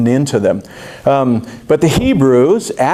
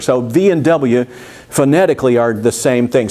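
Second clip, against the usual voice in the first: normal talking voice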